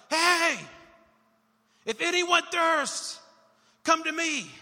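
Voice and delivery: shouted high and loud, slow; a bold cry to departing feast crowds; cries out. Retold or imitated. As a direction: bellow it across the crowd. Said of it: "And Jesus cries out".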